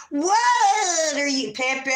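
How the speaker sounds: high, drawn-out pet talk; cooing at a pet. Real, not acted. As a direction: stretch it out high and playful. Breaks off to address pet.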